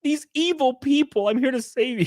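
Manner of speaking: high, earnest do-gooder voice, mocking; hammed-up preachy savior.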